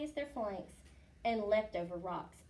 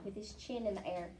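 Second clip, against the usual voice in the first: usual speaking voice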